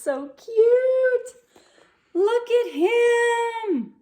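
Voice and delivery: delighted high squeal; excited gushing. Real, not acted. Showing puppy picture to children.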